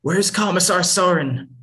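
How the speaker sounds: high and loud, barely held rage; angry demand. Acted. Mother confronting the lieutenant, angry demand. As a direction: confront him, voice pushed up hard.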